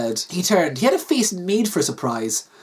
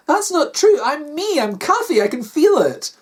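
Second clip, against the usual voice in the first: high, insistent woman character; distressed denial; 9 semitones higher than usual. Acted. Wife insisting to husband she is real, distressed. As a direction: protest higher, desperate to be believed. Kathy denies she is a program.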